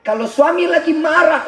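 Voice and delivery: ordinary delivery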